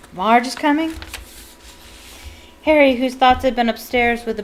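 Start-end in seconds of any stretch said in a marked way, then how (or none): 0.1-0.9 alarmed surprise. A narrator's reading. Petunia alarmed at news.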